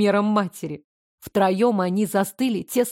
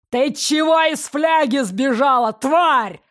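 loud, higher, hostile roar; angry yell; 4 semitones higher, 4 dB louder than usual. Acted. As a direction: yell it at the runaway daughter. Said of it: "заорал он".

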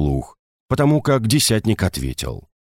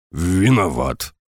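grumbled; 2 semitones lower, slower than usual. Acted. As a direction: grumble the apology unwillingly. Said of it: "буркнул десятник".

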